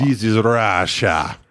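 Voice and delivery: slow, low put-on Russian accent; mock Russian accent. Quoting a movie, slow mock Russian accent. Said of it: "that guy's Russian accent".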